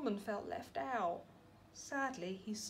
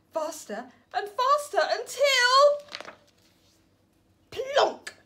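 slowing, louder, higher to a plonk; building tension; 13 semitones higher, 10 dB louder, slower than usual. A narrator's reading. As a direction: build the fall then land it. Norman falling fast.

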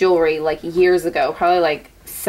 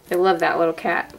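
ordinary delivery

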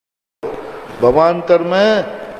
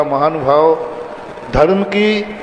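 ordinary delivery

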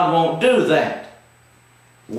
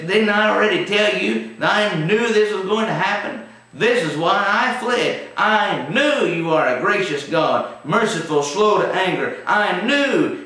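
complaining Jonah; 6 semitones higher than usual. Retold or imitated. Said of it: "he says".

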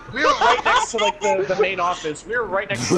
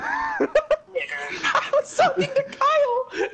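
much higher, slow, breaking into laughter; laughing hard; much slower than usual. Real, not acted. Friend explains he meant Kyle while cracking up, high and slow. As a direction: squeal it out through helpless laughter.

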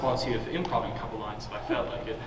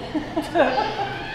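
villain chuckle; 13 semitones higher than usual. Acted. Villain gloats.

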